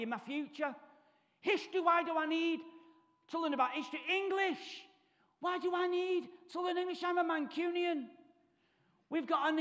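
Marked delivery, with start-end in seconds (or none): 1.4-8.1 higher, whiny schoolboy protest; mock teen whining.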